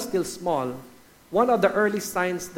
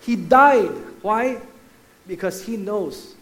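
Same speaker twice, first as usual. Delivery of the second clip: impassioned preaching; 4 semitones higher, 6 dB louder than usual. Acted. Preacher telling the congregation of the crucifixion, impassioned. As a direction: preach it with building force, peaking loud on "died".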